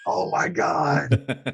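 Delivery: retold overwhelmed reaction, slow and high; mock shock. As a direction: stretch out the exasperated relief. Retelling relief after being pranked.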